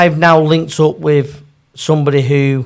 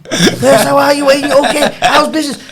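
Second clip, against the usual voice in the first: cheery manager; 11 semitones higher, 5 dB louder than usual. Retold or imitated. Manager now friendly.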